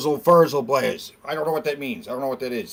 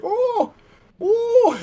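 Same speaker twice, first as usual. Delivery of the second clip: high mock squeal; 6 dB louder than usual. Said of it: "He was like".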